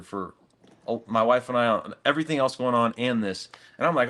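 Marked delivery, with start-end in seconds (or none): none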